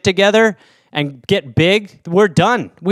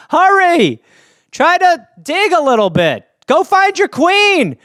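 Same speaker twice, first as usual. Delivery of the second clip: higher, louder, slow bossy urging; mock urging; 9 semitones higher, 3 dB louder, slower than usual. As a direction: hurry her along teasingly. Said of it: "you're like".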